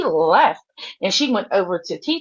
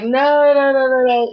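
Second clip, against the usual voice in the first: mimicked crowd protest; 5 semitones higher, 3 dB louder than usual. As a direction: imitate a whole crowd objecting.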